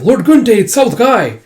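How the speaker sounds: high, loud warning cry; acted cry of alarm; urgent alarm. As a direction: call out the raid to your lord. Villager raises alarm of attack.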